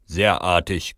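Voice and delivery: mild grumbled praise; approving grumble. A narrator's reading. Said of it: "grummel ich".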